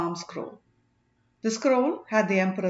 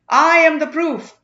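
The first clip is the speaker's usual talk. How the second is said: slow, loud, raised announcement; dramatic declaration; 7 semitones higher, 11 dB louder than usual. Acted. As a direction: step out of the shadows and proclaim it. Witness steps forward dramatically.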